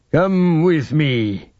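very slow, drawn-out order; stately, stretched-out command; commanding lord. Acted. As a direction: summon your followers grandly. Lord commands his followers.

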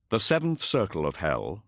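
ordinary delivery

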